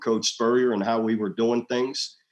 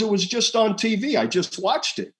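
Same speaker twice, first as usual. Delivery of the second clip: pitched up, insisting she saw it; insistent wife; 10 semitones higher than usual. Retold or imitated. Wife insisting she just saw it on TV. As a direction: voice the wife pressing her proof. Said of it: "she goes".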